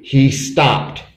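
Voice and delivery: usual speaking voice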